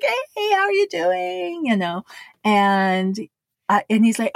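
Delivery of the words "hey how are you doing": put-on high bright hello to an old friend; sing-song hey much higher; cheery greeting. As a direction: greet him like it's been years.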